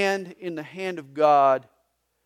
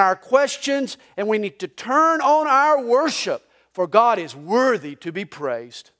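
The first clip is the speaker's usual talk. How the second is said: louder and higher, driving preaching; full-force preaching; 6 semitones higher, 10 dB louder than usual. Real, not acted. Pastor preaching Job's lesson at full force. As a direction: preach it to the back row.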